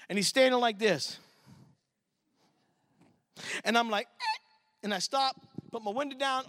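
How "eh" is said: startled squeak. Retold or imitated. Seeing the big policeman.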